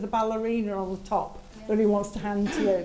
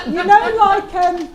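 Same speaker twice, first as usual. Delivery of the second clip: laughing; 9 semitones higher, 12 dB louder, slower than usual. Real, not acted. Laughing through the words.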